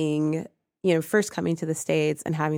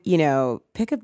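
ordinary delivery

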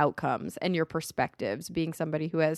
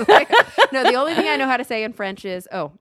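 ordinary talk

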